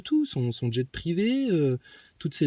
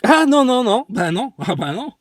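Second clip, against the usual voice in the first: loud outraged protest; 5 semitones higher, 9 dB louder than usual. Acted.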